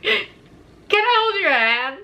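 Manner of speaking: high, slow, small frightened voice; scared plea. Retold or imitated. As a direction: beg him like a scared child. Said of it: "I'm scared".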